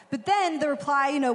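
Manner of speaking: ordinary talk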